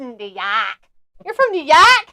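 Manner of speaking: hammed-up New York accent through laughter; badly faked Brooklyn accent, punching YORK; mock Brooklyn accent. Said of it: "Do you speak like a Brooklynder?".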